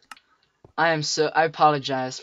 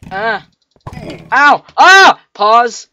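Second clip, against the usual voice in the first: loud, high yelps of panic; panicked screams; 8 semitones higher, 21 dB louder, much slower than usual. Real, not acted. Gamer about to die, loud panicked screaming. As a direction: scream while dodging. Nearly dying in game.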